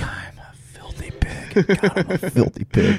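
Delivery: hushed, drawn-out self-abasing whisper; mock dirty whisper. Host plays along after co-host likes him dirty, mock dirty whisper. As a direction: whisper it slowly, playing dirty.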